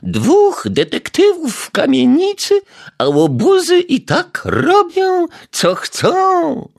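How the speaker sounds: put-on high, loud scolding old lady; grumbling neighbour. A narrator's reading. Old neighbour scolding through the door, high indignant voice. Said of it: "pomstowała pani Majewska".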